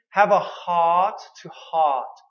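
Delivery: "have a heart to heart" said slowly; slow drill. Shown as a demonstration. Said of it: "So the expression".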